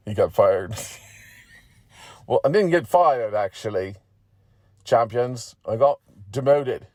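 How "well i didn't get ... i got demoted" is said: a hint of a miffed little champion; lightly voiced McGeady, protesting. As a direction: correct them, lightly in character.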